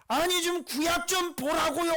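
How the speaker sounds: loud, high, exasperated plea; exasperated shout. Acted. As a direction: shout it in exasperation, drawing out the ending. Preacher exasperated, urging listeners to look.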